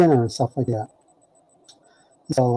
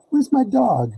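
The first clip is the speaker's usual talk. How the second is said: insistent question; 9 semitones higher, slower than usual. Retold or imitated. Teller insisting.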